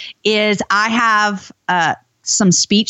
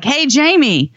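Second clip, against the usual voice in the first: husband's voice; 6 semitones higher, much slower than usual. Retold or imitated. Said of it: "he's like".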